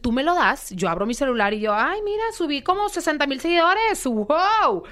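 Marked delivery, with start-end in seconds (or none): 1.7-4.8 much higher, thrilled; excited. Retold or imitated. Guest reliving seeing her phone after the show, much higher and thrilled. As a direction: gush over the new followers.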